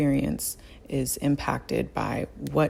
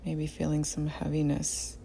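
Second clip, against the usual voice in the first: guided meditation; 6 dB quieter than usual. Real, not acted. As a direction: guide them gently through the body, unhurried. Leads a body scan meditation.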